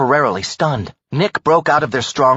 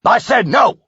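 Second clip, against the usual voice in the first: loud higher stern bark; barked like a drill sergeant; 11 semitones higher, 4 dB louder than usual. Acted. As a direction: command him like a drill sergeant. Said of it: "barked like a drill sergeant".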